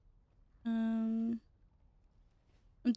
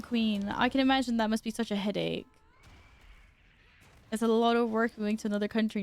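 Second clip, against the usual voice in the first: normal talking voice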